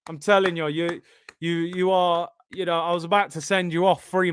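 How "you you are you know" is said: normal talking voice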